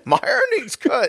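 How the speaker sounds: very high West Virginia imitation, laughing; mock West Virginia accent. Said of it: "she will say super West Virginia things".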